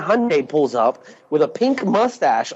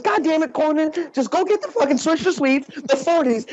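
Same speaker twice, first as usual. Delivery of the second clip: exasperated [name]; 12 semitones higher than usual. Retold or imitated. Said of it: "And he goes".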